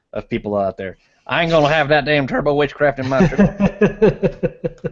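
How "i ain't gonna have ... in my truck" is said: mock a stubborn rural Southern truck owner, with a chuckle; mocking Southern accent. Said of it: "those people who have that accent".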